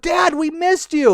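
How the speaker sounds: high-pitched joyful greeting; eager little-kid welcome; excited kids. Acted. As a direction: squeal it like children jumping up. Kids greet returning dad.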